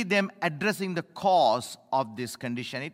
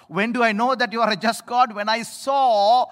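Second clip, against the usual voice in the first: preaching climax; 4 semitones higher, 5 dB louder than usual. Acted.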